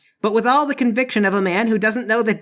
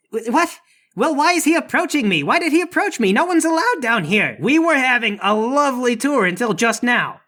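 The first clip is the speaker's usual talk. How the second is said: high-pitched, agitated protest; flustered guard; 6 semitones higher than usual. Acted. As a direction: defend yourself in a fluster. Guard challenged after dog attack.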